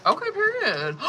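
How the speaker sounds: ordinary talk, nothing marked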